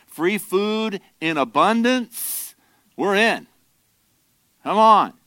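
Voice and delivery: greedy excitement at free food; hammed-up crowd cheering the offer; eager crowd. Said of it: "their attitude is".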